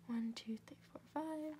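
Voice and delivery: counting in a whisper to herself. Real, not acted. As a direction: murmur the numbers under your breath. Counting stitches under breath.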